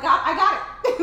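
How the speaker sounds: loud, high, laughing "God, I got it"; laughing excited shout. Retold or imitated. Retelling her prayer breakthrough to friends, laughing loudly. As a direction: shout it through a laugh of joy. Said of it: "I was so excited".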